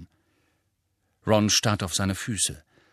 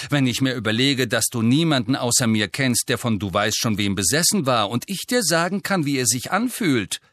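louder, higher, insistent rebuke; angry; 4 semitones higher, 6 dB louder than usual. A narrator's reading. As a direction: drive the reproach home angrily. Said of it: "sagte Ginny zornig". (zornig und vorwurfsvoll)

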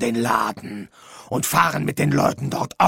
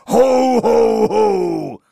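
booming Santa laugh, much louder, first ho higher; 8 semitones higher, 7 dB louder, much slower than usual. Pretend. Death overdoing the Santa laugh at every line. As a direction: tack on a big hearty ho ho ho. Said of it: "du brauchst nicht ständig".

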